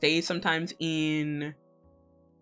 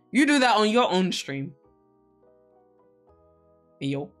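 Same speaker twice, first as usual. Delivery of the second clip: ordinary delivery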